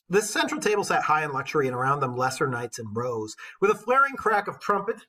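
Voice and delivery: put-on measured storytelling read of an old epic; steady, rhythmic verse narration; poem reading. A narrator's reading. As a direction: read it like a bard to the class. Teacher reads epic poem aloud.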